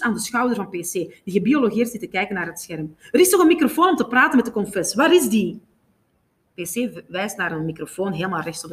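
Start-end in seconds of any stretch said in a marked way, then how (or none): 3.1-5.6 loud, high, rapid insistence; urgent, raised-voice questioning; agitated demand. A narrator's reading. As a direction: demand it frantically. Jamie frantically demands the microphone.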